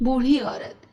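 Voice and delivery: usual speaking voice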